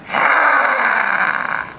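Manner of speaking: sustained jaguar cry; long fierce cry. Acted. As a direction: let out one long battle cry. Jaguar's cry after striking.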